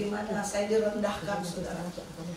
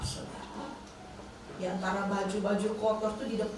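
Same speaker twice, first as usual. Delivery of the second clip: usual speaking voice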